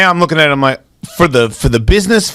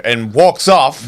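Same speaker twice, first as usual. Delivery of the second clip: ordinary talk, nothing marked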